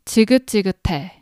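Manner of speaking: 지긋지긋해 run together quickly as one word; 지긋지긋해 said fast and smooth, no gaps. Shown as a demonstration. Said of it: "if you say this faster".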